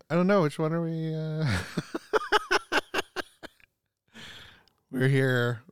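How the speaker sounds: ordinary talk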